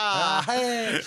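high, drawn-out mock Italian accent; put-on accent. Co-host riffing on where the band comes from, mock Italian accent. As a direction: exclaim in a playful Italian accent. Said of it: "je prends mon accent italien".

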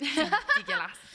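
high-pitched, slow, drawn-out "dégueulasse"; exaggerated redo. Mocking own odd voice, high drawn-out repeat. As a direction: stretch "dégueulasse" out high, making fun of yourself. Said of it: "pourquoi je parle comme ça?".

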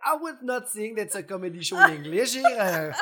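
high, loud, slow put-on English accent; mock English speaker. Mocking anglophones at a Quebec comedy show, high and loud. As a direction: play a baffled anglophone who won't understand. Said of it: "en faisant comme".

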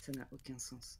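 muttered. Acted. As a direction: mutter it under your breath. Muttered bitter reply.

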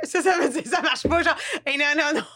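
playful laughing mimicry; laughing imitation. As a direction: giggle through the imitation. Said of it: "elle fait des bruits".

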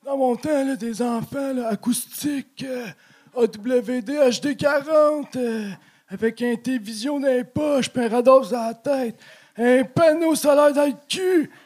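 put-on high, loud cranky old boomer. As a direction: rant like a grumpy old boomer. Boomer caricature, rire des boomers.

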